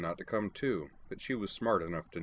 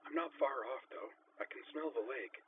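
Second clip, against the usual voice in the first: put-on higher, soft hushed girl's voice; higher and hushed, like over a radio; hushed young woman; 7 semitones higher, 8 dB quieter than usual. A narrator's reading. Sakura whispering over radio.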